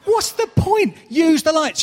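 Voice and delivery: high, loud incredulous outburst; can't believe how dumb they are; yelling at a character who won't turn the lights on; exasperated at the TV. Pretend.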